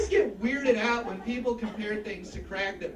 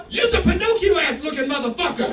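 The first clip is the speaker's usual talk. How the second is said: loud, raised-pitch mock tirade; kid's insult yell; 4 semitones higher, 6 dB louder than usual. Student insults teacher from timeout room, mock angry yell. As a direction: hurl it like an angry pupil.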